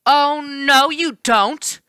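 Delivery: high, loud stern "oh no you don't"; sharp indignant naiad; stern refusal. A narrator's reading. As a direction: shut him down firmly. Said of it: "She had a stern look on her face".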